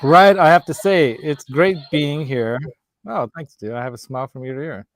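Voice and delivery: normal talking voice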